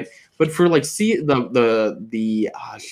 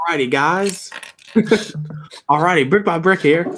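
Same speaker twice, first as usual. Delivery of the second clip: higher mock streamer greeting; imitating co-host; 5 semitones higher than usual. Pretending to be the co-host greeting viewers, playful impersonation. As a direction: do a playful take on your co-host's intro. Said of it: "you're gonna pretend to be me".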